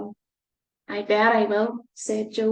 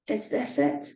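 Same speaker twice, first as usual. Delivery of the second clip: ordinary delivery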